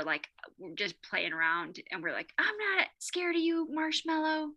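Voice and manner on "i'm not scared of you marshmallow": higher-pitched pretend bravery; playful defiance. Parent playing Frozen with daughter, mock brave. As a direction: stand up to a pretend villain, high and childlike. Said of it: "We were pretending".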